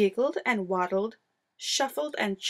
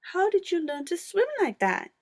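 put-on young Wombat voice; 8 semitones higher than usual. A narrator's reading. Wombat asks, voiced high.

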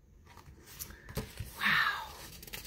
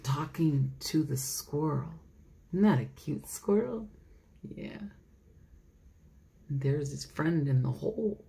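usual speaking voice

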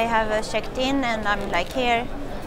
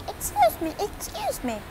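mock polite passengers; 10 semitones higher, 4 dB quieter than usual. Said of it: "Everyone is".

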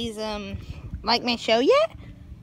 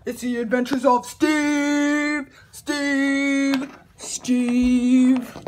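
slow, stretched-out show title; hammed-up announcer intro; mock title announcement; much slower than usual. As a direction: draw out the name like an echo.

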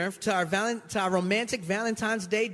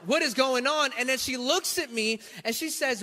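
ordinary delivery

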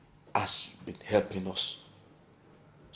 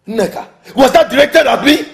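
loud, high outraged question; indignant yell; affronted shout; 9 semitones higher, 14 dB louder than usual. Acted. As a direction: demand it again at full voice, offended. Affronted repeat, much louder.

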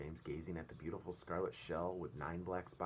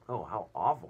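loud shocked cry; loud horrified cry; cried out; 2 semitones higher, 10 dB louder than usual. A narrator's reading. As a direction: gasp it out loudly. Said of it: "cried James".

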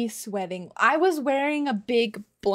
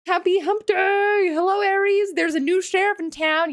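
high, loud excited greeting; 7 semitones higher, 6 dB louder than usual. Real, not acted. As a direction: burst out with a loud cheer. Greeting new viewer excitedly.

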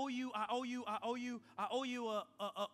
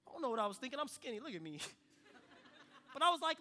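normal talking voice

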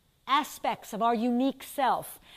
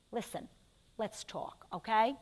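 near-whisper, much softer, leaning in close; hushed confiding; 3 semitones lower, 10 dB quieter than usual. Acted. The mind quietly calls its body aside, hushed confiding. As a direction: murmur it like a secret between friends. Body's cells quietly asking to talk.

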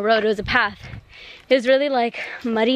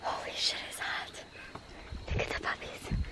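breathy hushed whisper; 18 dB quieter than usual. Real, not acted. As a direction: drop to a whisper. Hushed remarks on a hot walk.

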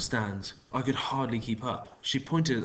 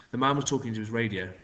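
ordinary delivery, nothing marked

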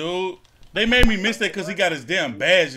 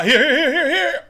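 here here, pitched up high; calling for the ball; 9 semitones higher, 5 dB louder than usual. Real, not acted. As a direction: call for the pass, rising high.

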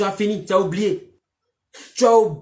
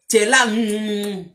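mock speechless mumbling. Mimics the man left speechless. (imitation moqueuse d'un homme sans voix, lente)

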